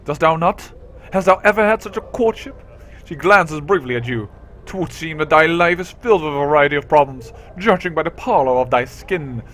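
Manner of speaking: put-on lightly done old British accent, archaic; a hint of old British accent, haughty. Juliet mocks the hero's pallor, old British accent. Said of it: "some type of old British England accent".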